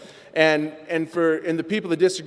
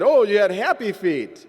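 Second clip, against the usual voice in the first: slow, higher, louder mock jeer; mocking taunt; 5 semitones higher, 4 dB louder than usual. Imagined greeter teasing the runaway newcomer, slow higher mock jeer. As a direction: tease him for running out.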